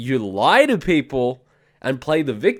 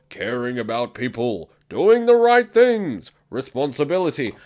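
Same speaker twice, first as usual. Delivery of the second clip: mocking. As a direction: drag it out mockingly in a prissy corporate voice. Mocking corporate mission statements.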